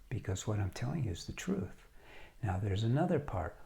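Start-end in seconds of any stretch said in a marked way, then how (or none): 0.1-1.9 whispered, quick and secret; confiding whisper. Real, not acted. Marriage coach whispering the truth to a doubting husband, fast.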